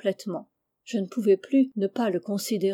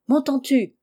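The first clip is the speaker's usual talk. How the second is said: higher, louder insistent question; raised, urgent call; 5 semitones higher, 5 dB louder than usual. A narrator's reading. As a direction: call up to check you are heard. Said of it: "criait-il, sans m'écouter".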